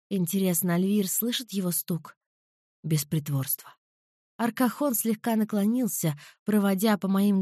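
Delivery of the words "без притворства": lower, soft man's voice; low male voice. A narrator's reading. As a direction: answer low and quiet. Alvir repeats low.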